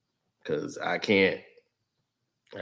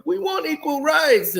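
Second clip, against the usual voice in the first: high whiny mock protest voice; lampooning idiots in the chat; mocking chatter; 3 dB louder than usual. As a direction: sneer it in a high mocking whine.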